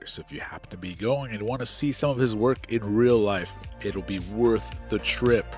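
ordinary talk, nothing marked

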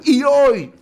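high, loud burst on the turning point; ringing retold proclamation; emphatic declaration. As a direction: lift it sharply, proclaiming the big moment. Retold climax of woman's forgiveness speech.

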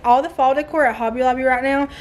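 usual speaking voice

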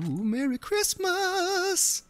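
very high, drawn-out jingle voice; high, slow novelty greeting; sound-machine voice. Pretend. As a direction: squeeze it out slowly like a toy sound button. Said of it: "I'm a little sound".

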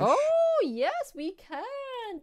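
high, slow, delighted yes, we can; light up at the viewer's idea; excited agreement, drawn out. Real, not acted. Thrilled by a viewer request.

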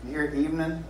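normal talking voice

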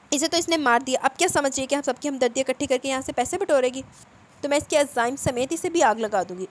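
loud, heated tirade with hard emphasis; angry shouting. A narrator's reading. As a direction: rage at the family, acted by a narrator. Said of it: "वो गुस्से से चिल्लाई". (गुस्से से चिल्लाते हुए)

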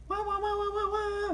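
very high, repeated whistle toots; shrieky sing-out like a steam whistle; imitated train whistle. A narrator's reading. Thomas wakes Emily, imitated engine whistle. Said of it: "whistled Thomas".

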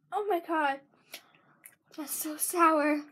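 talking around a chewy gummy; chewing while talking. Real, not acted. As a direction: mouth partly full of candy. Seen 2: Left girl bit the gummy at -5; her cheeks stay full while she speaks.

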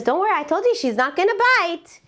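high, insistent imitated host; insistent reassurance. Dog owner to the man who tenses up, high insistent reassurance. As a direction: reassure with the pitch pushed up.

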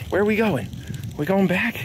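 high voice asking the dog; sweet talk to the pup; pet talk. Real, not acted. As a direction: chirp questions at the dog. Talking to the dog on walk.

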